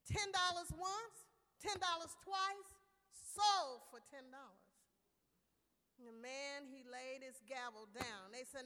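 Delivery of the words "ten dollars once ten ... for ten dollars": loud, ringing going-once call; auctioneer closing. A narrator's reading. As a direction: bang out the sale to the room.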